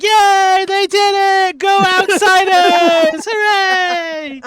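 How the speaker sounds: high, loud, drawn-out cheers; hammed-up victory cheer, very high and loud; cheering crowd. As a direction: whoop like a whole crowd celebrating. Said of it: "you see everybody that is like".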